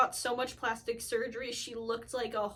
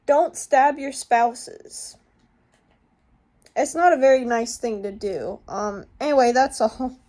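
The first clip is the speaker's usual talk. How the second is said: loud apology; 10 dB louder than usual. Real, not acted. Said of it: "forgotten to add commentary".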